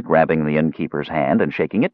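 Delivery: plain reading voice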